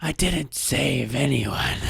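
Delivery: slow, winded old-man impression; Clint Eastwood. Podcaster imitating an old actor in his movie, slow and breathless. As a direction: drag the words out as if short of breath. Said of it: "He's so out of breath".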